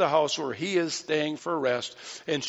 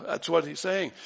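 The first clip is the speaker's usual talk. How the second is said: ordinary talk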